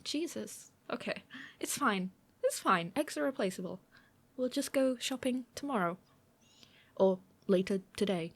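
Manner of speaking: ordinary talk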